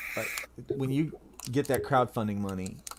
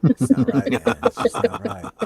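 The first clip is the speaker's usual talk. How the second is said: high, loud, strange cartoon voice; put-on voice; 10 semitones higher, 5 dB louder than usual. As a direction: ham it up as an odd cartoon voice. Joking in a put-on voice.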